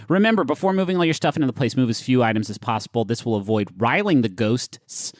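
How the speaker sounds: ordinary talk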